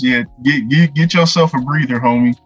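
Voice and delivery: ordinary talk, nothing marked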